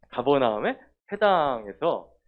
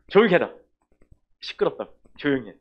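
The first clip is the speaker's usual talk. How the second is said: commanding; 3 semitones higher, 9 dB louder than usual. Acted. Jesus rebuking the demon in the synagogue, loud command. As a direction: order the demon out with full force. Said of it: "명령을 하셨어".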